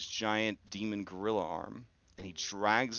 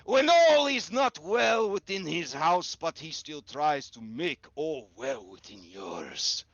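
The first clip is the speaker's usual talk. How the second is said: higher, cold accusing lord; menacing Baron; 7 semitones higher, 4 dB louder than usual. Acted. Baron accusing the nervous man of mockery, menacing. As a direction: twist the laugh into a confession.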